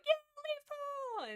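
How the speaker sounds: very high mock cheer; cheering crowd. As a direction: squeal it like a crowd welcoming a hero. Clan cheers returning Leaf Paw.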